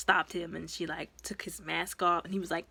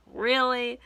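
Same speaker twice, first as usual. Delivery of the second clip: Really? pushed up, higher and louder; exasperated, louder second Really?; louder disbelief; 3 semitones higher, 4 dB louder than usual. Retold or imitated. Viewer reacting to Robin's betrayal, loud disbelief. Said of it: "And I'm just like".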